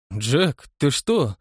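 usual speaking voice

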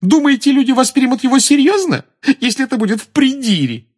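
very high, loud derisive question; high-pitched, incredulous mockery; amused disbelief. A narrator's reading. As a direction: scoff as if it were hilarious. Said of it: "выговорила она со смехом".

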